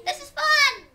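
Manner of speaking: high, loud, gleeful monkey squeal; naughty baby monkey. Shown as a demonstration. Baby monkey swinging in a treetop, high and gleeful. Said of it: "baby monkey, you're very, very naughty".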